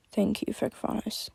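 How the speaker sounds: soft unvoiced whisper. A narrator's reading. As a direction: breathe a grateful whisper. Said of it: "she whispered".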